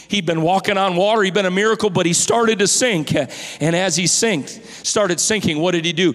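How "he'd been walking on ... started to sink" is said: ordinary talk, nothing marked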